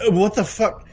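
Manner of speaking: stunned at the sight of them; shocked. Retold or imitated. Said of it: "I'm like".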